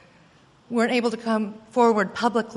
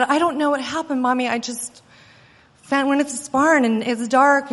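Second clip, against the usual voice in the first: put-on upset little girl; 6 semitones higher, 4 dB louder than usual. Scared child explaining to angry mother.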